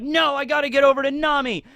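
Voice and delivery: put-on desperate little girl. Girl racing to reach Nami, high and urgent. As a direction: squeak it out like a kid in a hurry.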